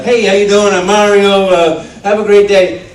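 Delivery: mock the rough New York plumber take; gruff plumber. Actor mocking rival auditions, gruff loud plumber. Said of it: "only person who didn't go".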